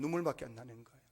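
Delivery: low, soft repeat of "눈물밖에"; subdued echo. Real, not acted. Preacher repeating Hannah's misery to the congregation, subdued and low. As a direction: let "눈물밖에" sink low and quiet. Hushed repeat of the same words.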